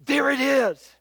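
a mock spooky whisper of a warning; a hint of a terrified wife pointing in the dark; terrifying voice. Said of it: "in that terrifying voice".

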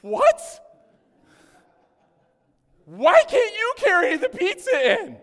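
high, loud, slow indignant shout; exasperated outburst. Retold or imitated. Pastor retelling snapping at Michelle about pizzas, loud shout. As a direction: bark it back in disbelief. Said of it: "I didn't hold that in".

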